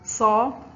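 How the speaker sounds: loud, clear announcement of صا; loud teacher naming the letter صا; letter announced. Shown as a demonstration. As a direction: call out صا to the class. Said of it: "حرف الصاد محرك بحركة الفتح".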